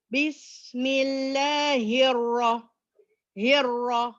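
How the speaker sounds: "Bismillahirrah-" slow with full heavy ra. Shown as a demonstration. Teacher models heavy ra after warning, slow. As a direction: stretch it slowly, make the ra heavy. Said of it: "Ra is heavy".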